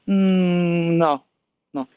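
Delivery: dismissive monkey refusal; a hint of a bored old monkey. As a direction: brush him off as the monkey. Monkey refuses, not interested.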